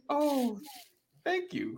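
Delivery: soft falsetto squeak; mock-delighted, very high little voice. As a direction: go way up high and soft, as if touched by a gift. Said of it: "you gotta get the high voice".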